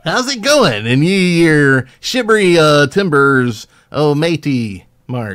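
mock pirate voice, slow; hammy pirate talk; anti-pirate accent. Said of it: "Your, like, anti-pirate accent is so weird".

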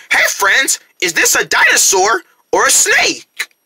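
ordinary talk, nothing marked

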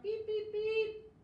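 high, loud playful beeps; truck horn beep. Pretend. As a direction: beep like a toy truck for the kids. Kids join truck horn sound.

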